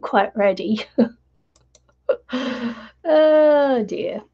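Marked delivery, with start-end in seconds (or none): none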